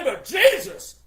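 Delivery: high, loud cry of disbelief; exasperated outburst. Real, not acted. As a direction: burst out in exasperation.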